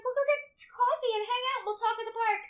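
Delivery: high, bright friend voice; cheery friend voice. Retold or imitated. Imitating friend Jen inviting her out, high and cheery. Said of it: "she was like".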